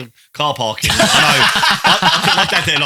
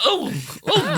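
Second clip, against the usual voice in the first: high mock exclamation. Doctor voiced in story.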